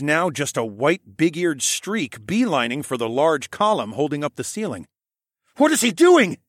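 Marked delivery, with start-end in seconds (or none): 5.6-6.4 high, loud, shocked question; alarmed gasp. A narrator's reading. Alex watching the elder run off, gasping in alarm. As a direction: gasp it out in disbelief. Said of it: "I gasped".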